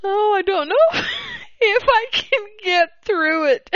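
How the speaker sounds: high-pitched words squeezed through real laughter; helpless laughing. Real, not acted. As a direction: giggle helplessly as you try to speak. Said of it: "get through this without laughing".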